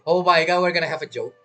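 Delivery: ordinary talk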